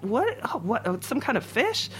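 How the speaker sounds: lost and guessing aloud; new cook puzzling in the basement, high and bewildered; baffled. Retold or imitated. Confused cook puzzling over order.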